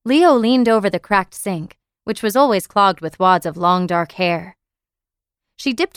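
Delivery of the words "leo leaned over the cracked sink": ordinary delivery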